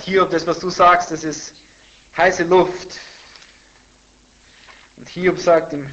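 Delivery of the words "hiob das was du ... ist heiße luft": louder, higher dismissive quote; hardening tone. Retold or imitated. Friend rebuking Job in the debate, loud and dismissive. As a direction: throw it out as a heated put-down. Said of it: "der Ton wird immer härter".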